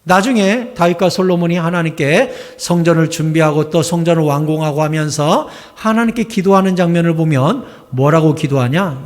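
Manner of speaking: ordinary talk, nothing marked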